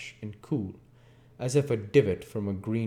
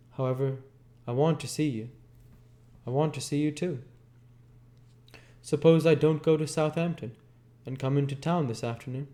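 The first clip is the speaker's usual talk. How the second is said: higher, lightly voiced Jordan; Jordan on phone; 7 semitones higher than usual. A narrator's reading. Jordan pressing Nick on the phone, higher. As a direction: lift into her cool voice. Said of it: "Jordan on the phone".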